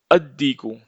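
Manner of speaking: अद्दीकु with doubled d, no L; silent L. Shown as a demonstration. Teacher models another sun letter, अद्दीकु with long d. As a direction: hold the d in अद्दीकु, dropping the L. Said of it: "उसी को हम बोलते हैं हुरूफ़े शम्सी".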